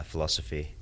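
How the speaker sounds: ordinary talk, nothing marked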